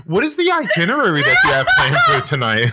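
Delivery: hammed-up posh accent, every word formal; mock posh accent. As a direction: put on a fussy posh accent. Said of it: "please continue on with the accent".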